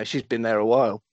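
ordinary delivery

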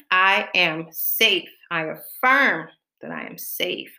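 usual speaking voice

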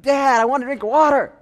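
put-on high, loud whiny child asking for water; little kid voice. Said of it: "the kid's like".

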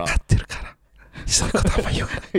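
quick hushed whisper. Real, not acted. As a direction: keep it secret, whisper fast. Keeps whispering after being told.

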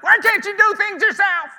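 high, loud frustrated cry; exasperated outburst. Retold or imitated. Fed-up helper to a needy person, loud exasperated cry. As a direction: let the frustration burst out.

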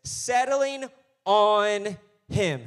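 Settling on him dragged out slow and loud; preacher slowing to make it land; slow and weighty. Shown as a demonstration. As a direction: linger on each word of settling. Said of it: "And listen".

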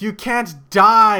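hammed-up wail, high, loud and drawn out; anguished cry. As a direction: cry it out slowly in mock despair. Ash devastated as Celebi dies.

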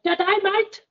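very high mock Australian woman; female Australian accent. Showing a reluctant guest how it's done, female Australian accent. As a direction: squeak out a female Aussie accent. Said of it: "You've gotta do female Australian".

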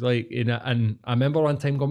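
normal talking voice

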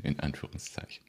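low whispered aside; whispering. Real, not acted. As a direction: whisper it like a secret.

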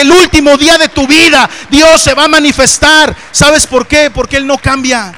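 builds from steady to loud, high preaching; rising preacher shout. Real, not acted. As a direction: swell into a full-voiced proclamation.

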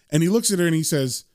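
usual speaking voice

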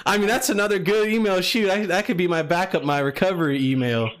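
lightly put-on Southern accent. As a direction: talk like a Southern caller. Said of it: "I like the country accent".

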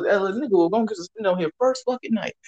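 ordinary delivery

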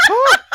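loud, very high mock gasp of horror; horrified gasp. Manager learns he's not the dad, horrified gasp. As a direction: gasp sharply as the mistake lands. Boss's horrified realisation retold.

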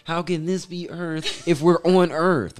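retelling an upset beachgoer who is confused that soil is called Earth; upset woman. Retold or imitated. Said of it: "And she's like".

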